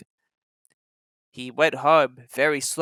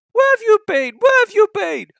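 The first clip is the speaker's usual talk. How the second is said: high, loud cross complaint; cross coaches; 8 dB louder than usual. Acted. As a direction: scold the late engine. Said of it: "asked the coaches crossly".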